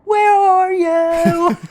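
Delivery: high, loud, slow wailed lyric; yelled song lyric. Retold or imitated. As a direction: belt it from another room. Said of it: "you just hear him go".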